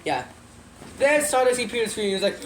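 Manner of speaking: ordinary delivery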